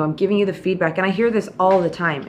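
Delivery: usual speaking voice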